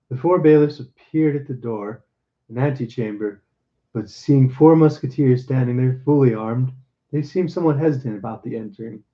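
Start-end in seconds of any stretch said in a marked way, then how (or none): none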